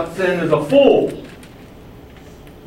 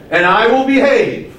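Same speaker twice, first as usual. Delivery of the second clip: higher, emphatic second vow; resolute declaration; 4 semitones higher, 3 dB louder than usual. Acted. Preacher models the second half of the believer's pledge, resolute declaration. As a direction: pledge it firmly, voice lifted. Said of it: "then you need to say".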